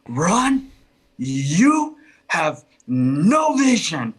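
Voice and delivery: retold scolding, drawn out and emphatic; slow, pointed mock yell at a husband. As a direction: imitate a wife telling off her husband, stretching the words slowly. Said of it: "like, yelling at him".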